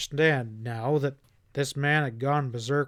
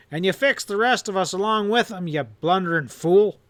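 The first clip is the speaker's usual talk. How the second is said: furious roar, louder and higher; 5 semitones higher, 5 dB louder than usual. A narrator's reading. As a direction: roar it at the fool. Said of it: "Hanlon roared".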